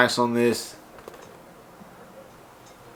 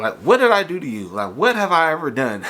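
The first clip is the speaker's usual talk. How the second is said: exasperated; 6 semitones higher than usual. Real, not acted. Exasperated at damaged package.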